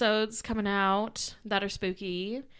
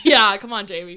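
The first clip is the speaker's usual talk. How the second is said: loud mock complaint; 10 dB louder than usual. Real, not acted.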